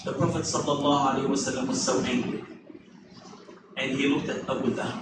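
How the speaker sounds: normal talking voice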